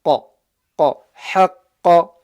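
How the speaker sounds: QA, HAQQA with a loud, emphatic back qaf; back-of-mouth qaf. Shown as a demonstration. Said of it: "from the back of the mouth".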